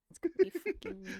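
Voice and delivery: high loud outburst, fast. Real, not acted. Streamer yelling at the video, half joking. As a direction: blurt it out, suddenly loud.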